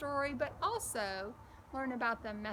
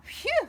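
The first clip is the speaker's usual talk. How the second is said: exaggerated phew; 11 dB louder than usual. Bo reacting to busy day.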